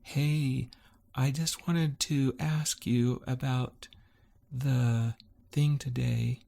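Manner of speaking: high, slow sugary imitation; really sweet. Wife dictating a sensitive text, imitated really sweet. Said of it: "voice that's, uh, really sweet".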